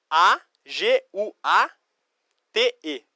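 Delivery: slow, letter-by-letter spelling. Shown as a demonstration. Teacher spells the Spanish word for students, slow spelling. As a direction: spell it out slowly with gaps between letters. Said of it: "É água".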